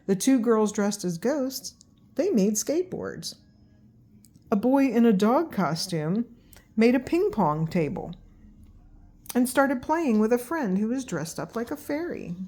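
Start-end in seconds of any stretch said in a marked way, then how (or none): none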